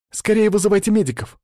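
put-on high-pitched urgent girl's cry; urgent exclamation. A narrator's reading. Girl calls for medics for her friend, urgent exclamation. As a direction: cry out for help, pitched well up. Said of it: "воскликнула Анжела".

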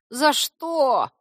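high, loud, pouting creature; sulky. A narrator's reading. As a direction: protest like someone wrongly scolded. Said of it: "надулся Корневик".

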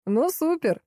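high-pitched jeering exclamation; derisive, pitched well up; mocking guffaw. A narrator's reading. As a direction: crow it high with mock delight. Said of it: "захохотал Алик".

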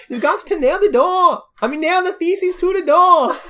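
high, loud, badly faked German accent; hammed-up urging in a wobbly mix of accents, meant as German; muddled German accent. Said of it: "There's so many accents there".

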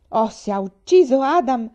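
loud, high burst of outrage; she's about to storm off; furious outcry. Acted. Faith rages that the cook killed Adam, loud outcry.

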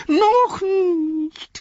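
high pleading whine on noch; high whiny Smeagol. Acted. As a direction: beg in a thin high voice. Smeagol pleading against Gollum.

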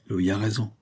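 understated, resigned concession; bitter. A narrator's reading. Old marquis yields to Louis's reasoning, bitterly. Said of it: "dit amèrement".

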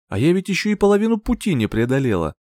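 plain reading voice

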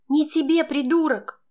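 high, loud angry retort; snapping back. A narrator's reading. Girl jerking away from Max's hands, snapping back. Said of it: "огрызнулась я".

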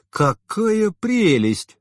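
high slow wistful sigh; dreamy. A narrator's reading. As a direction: say it lost in memory. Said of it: "совсем другим, задумчивым тоном".

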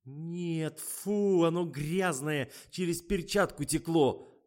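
high, slow disgusted whine; whining. A narrator's reading. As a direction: moan in revulsion, drawn out. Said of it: "ныла Фатима".

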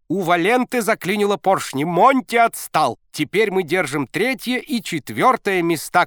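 high loud excited shout; shouting coach. A narrator's reading. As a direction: call out the news. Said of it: "кричал тренер".

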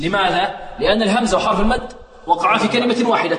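ordinary talk

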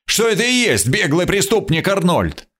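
high, heated insistence; narrated indignant cry; furious shout. A narrator's reading. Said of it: "закричал бешено второй".

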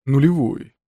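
put-on firm old man voice; stubborn great-grandfather correcting; old man insisting. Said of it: "Зафот Библброкс нулевой".

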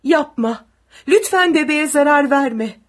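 high, loud desperate plea; tearful pleading. A narrator's reading. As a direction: beg a soldier to spare the baby. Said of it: "Sabrina ağlayarak".

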